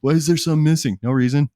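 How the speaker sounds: high, loud, playful fake denial; mock innocent. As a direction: act like nothing happened. Joking about missing beers.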